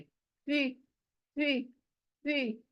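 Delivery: soft, heavy सी with cupped, rounded lips; teacher's final demo. Shown as a demonstration. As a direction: shape the lips like a cup for सी. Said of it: "प्याला जैसा गोल".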